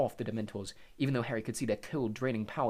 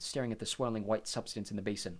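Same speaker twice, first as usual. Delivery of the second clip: ordinary delivery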